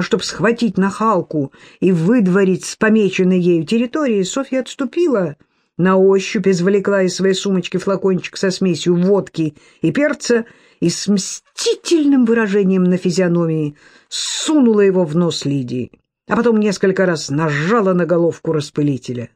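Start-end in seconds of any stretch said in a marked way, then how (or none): none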